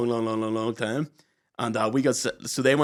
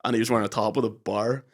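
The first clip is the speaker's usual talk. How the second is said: ordinary talk, nothing marked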